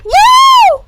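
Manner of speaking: a very high, loud whoop; cheer. A narrator's reading. Izuku cheering after convincing Sensei, a high loud whoop. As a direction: whoop it out with joy. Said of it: "Izuku cheered".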